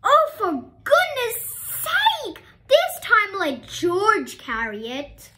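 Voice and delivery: high, loud, slow exasperation; fed-up teacher; exasperated. A narrator's reading. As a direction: throw up your hands at her.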